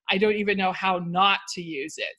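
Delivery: emphasize "not" within the sentence; stress on NOT. Shown as a demonstration. Teacher showing which word needs italics, stress on "not". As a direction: make "not" the word that stands out. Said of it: "I put the emphasis on that word".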